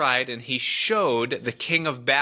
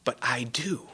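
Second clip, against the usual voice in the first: whispered, knowing reply; soft acted whisper; hushed assurance; 5 dB quieter than usual. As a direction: drop to a whisper, as God confiding. God's knowing reply to the protest.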